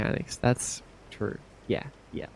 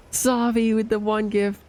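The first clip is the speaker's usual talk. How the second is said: hyped; 7 semitones higher than usual. Real, not acted. Hyped gift shout-out.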